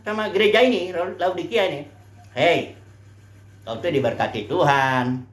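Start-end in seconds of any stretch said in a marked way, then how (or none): 2.3-5.3 low, slow coaxing; devil's flattery. Retold or imitated. Preacher voicing Satan's low, slow flattery to the church. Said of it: "setan bilangin sama kamu".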